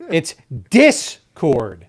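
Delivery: slow Discord with the weight on "Dis"; stress on DIS. Shown as a demonstration. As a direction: say "Discord" slowly, punching "Dis". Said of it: "the stress is on the first syllable".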